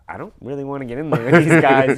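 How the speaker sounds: mock-alarmed loud aside; nervous dread. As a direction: recoil at getting in there. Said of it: "I remember being like".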